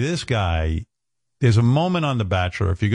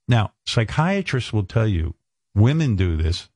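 ordinary talk, nothing marked

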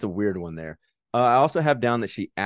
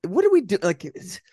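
bewildered disbelief. Retold or imitated. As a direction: act out an utterly confused viewer. Retold bewildered viewer reaction.

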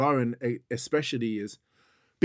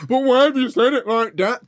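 mocking her complaint; 9 semitones higher, 7 dB louder than usual. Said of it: "I don't wanna hear".

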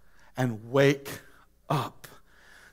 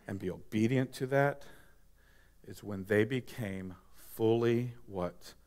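soft altar-call preaching; 11 semitones lower, 10 dB quieter than usual. Acted. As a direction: draw them in gently. Quiet reflective preaching.

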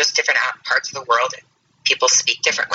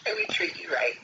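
whispered ad line; 10 dB quieter than usual. Pretend. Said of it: "brochures for Canadian jail".

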